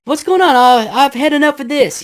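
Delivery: high, loud, rattled shout; freaked-out yell. Retold or imitated. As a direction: yell it like you're done with this place. Freaked-out guy on radio.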